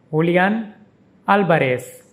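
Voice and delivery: Julian as hoo-lee-AHN, Alvarez as AL-ba-res; Spanish pronunciation. Shown as a demonstration. Teacher demonstrates the footballer's name, saying it the Spanish way. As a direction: Julian with an h sound and stress on the last syllable, Alvarez with a b for v and an s ending. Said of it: "is pronounced".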